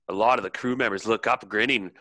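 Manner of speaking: ordinary delivery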